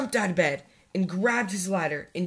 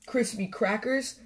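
plain reading voice

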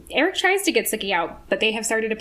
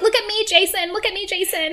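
high, hammed-up frantic girlfriend; pleading bar-fight girlfriend; 7 semitones higher than usual. Girlfriend stopping boyfriend's bar fight, mock frantic pleading. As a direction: beg for his attention in mock panic. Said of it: "every single girl where their boyfriend is gonna fight".

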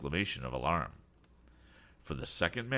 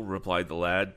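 ordinary delivery, nothing marked

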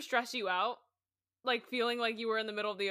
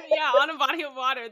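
laughing; 6 semitones higher, 9 dB louder than usual. Real, not acted. Laughing at friend's line.

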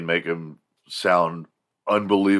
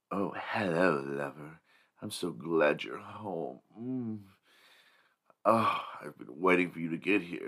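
sultry voice; 10 dB quieter than usual. Retold or imitated. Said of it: "And she's like".

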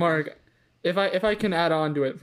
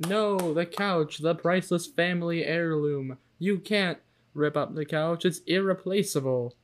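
usual speaking voice

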